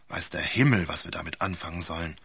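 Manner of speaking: ordinary delivery, nothing marked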